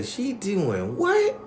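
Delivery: high, stretched-out question; incredulous grandma. Retold or imitated. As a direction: imitate grandma aghast, dragging the words out. Grandma reacts to the ritual.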